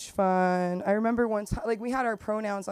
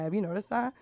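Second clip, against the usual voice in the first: usual speaking voice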